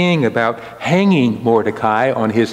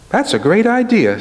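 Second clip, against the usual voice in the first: a high, eager king, thrilled with the idea; bright jump up, sold on it at once; delighted king; 10 semitones higher than usual. Acted. Preacher voicing the king pleased with Haman's plan, eager and high.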